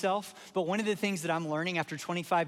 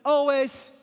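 called out high and loud; exclaimed always; 7 semitones higher, 8 dB louder than usual. Retold or imitated. Pastor voicing Paul's answer to the congregation, exclaimed. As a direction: ring out the word like a proclamation. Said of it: "And he goes".

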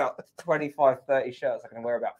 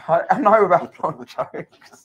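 louder, slow words breaking into laughter; laughing; 4 semitones higher, 7 dB louder, slower than usual. Real, not acted. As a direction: crack up as you say it. Laughs at own remark.

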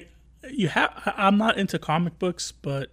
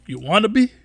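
raised, higher, menacing cop; tough cop voice; 5 semitones higher, 7 dB louder than usual. Retold or imitated. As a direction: lean in and threaten. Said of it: "He's like".

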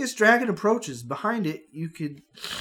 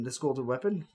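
ordinary talk

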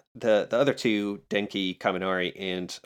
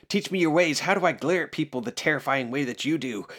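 eager plea; 6 semitones higher than usual. Retold or imitated. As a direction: ask to be taught, all fired up. Ida earnestly begs Bakugo.

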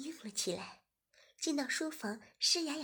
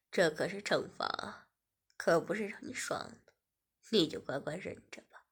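stern teasing man; 5 semitones lower than usual. Acted. Host telling Shiya it is punishment, lower.

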